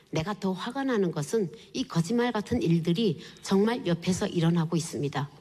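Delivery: ordinary talk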